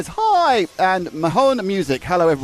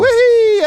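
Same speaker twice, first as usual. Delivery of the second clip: gleeful whoop; 14 semitones higher, 6 dB louder than usual. Real, not acted. Celebrating a new fan hero.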